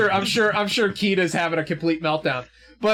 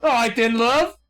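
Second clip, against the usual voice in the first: put-on high Aussie rogue accent; put-on Aussie, pitched well up; Kano; 6 semitones higher, 4 dB louder than usual. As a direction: try out a cheeky Australian crook. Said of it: "[name] is now Kano".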